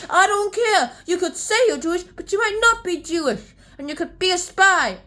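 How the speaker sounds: high, loud acted scolding; indignant accusation. Acting Lady Sorton's indignant accusation.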